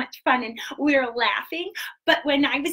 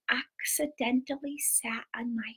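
hushed, slower secret whisper; 4 semitones lower, 10 dB quieter, slower than usual. Acted. Secret told to the kids.

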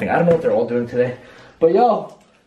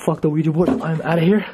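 normal talking voice

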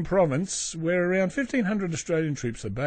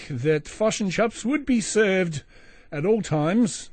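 slow, higher New Zealand vowels; mock New Zealand accent; 5 semitones higher, slower than usual. Podcaster mocking the neighbours' statement, slow New Zealand accent. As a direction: put on a slow Kiwi accent. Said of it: "Australian neighbours New Zealand".

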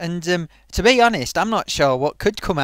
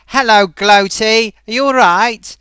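loud, slow put-on puppet voice greeting the host; a loud hammed-up puppet voice; puppet character voice; 3 semitones higher, 8 dB louder than usual. Puppet greeting Gloaty, loud put-on voice.

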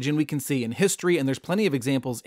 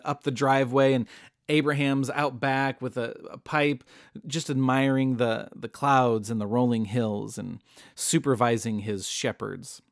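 usual speaking voice

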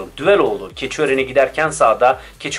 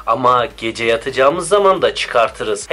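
usual speaking voice

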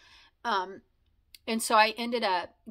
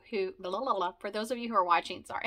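ordinary talk, nothing marked

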